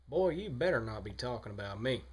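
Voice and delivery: put-on a touchy Carolina good ol' boy. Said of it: "the guy he tried to become".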